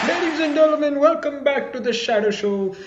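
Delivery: high-pitched showman's welcome; show host opening. Acted. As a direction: announce the show in a high, ringing voice.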